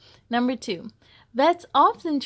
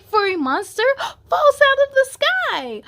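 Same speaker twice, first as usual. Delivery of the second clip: high, loud gasp of shock mid-line; dramatic surprise; 13 semitones higher, 9 dB louder than usual. Acted. Narrator reading the monster's fall, dramatic surprised gasp. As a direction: jump up in pitch as the monster drops in.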